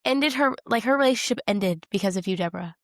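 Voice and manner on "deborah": normal talking voice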